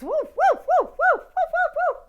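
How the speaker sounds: loud high-pitched woofs; high yappy barking. Acted. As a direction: bark like a small excited dog. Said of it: "barking at all the cars".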